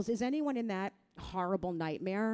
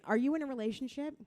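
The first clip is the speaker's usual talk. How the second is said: normal talking voice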